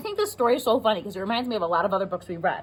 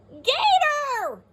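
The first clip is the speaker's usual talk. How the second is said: frog screaming. Acted. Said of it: "the frog screams".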